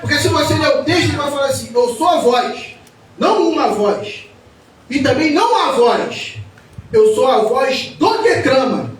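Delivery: loud, high, impassioned sermon delivery; fiery preaching. Acted. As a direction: preach it out to the congregation. Preaching at full force.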